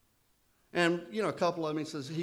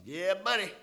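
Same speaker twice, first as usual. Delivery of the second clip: put-on old timer's reply; 6 semitones higher, 5 dB louder than usual. Bob reassures the narrator.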